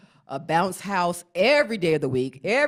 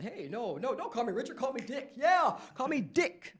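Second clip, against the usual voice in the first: laughing imitation; 7 semitones higher, 6 dB quieter than usual. Proud Dick voiced, laughing.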